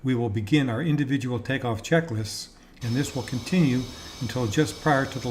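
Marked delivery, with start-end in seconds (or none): none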